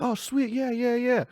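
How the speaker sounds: very high, delighted "oh sweet"; excited high squeal. Retold or imitated. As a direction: squeal with sudden joy.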